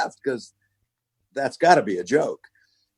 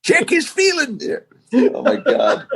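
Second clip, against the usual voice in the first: laughing. Real, not acted. Laughing, high squeal.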